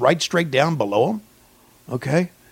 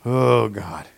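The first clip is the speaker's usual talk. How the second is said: ordinary talk, nothing marked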